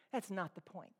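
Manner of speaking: normal talking voice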